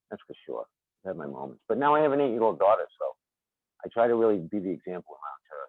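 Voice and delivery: ordinary delivery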